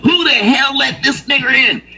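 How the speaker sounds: high mock indignant voice. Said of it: "He said".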